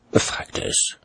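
ordinary delivery

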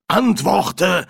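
loud, high, sharp order; commanding. Acted. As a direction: demand an answer from the spirit. Medium commands silent spirit.

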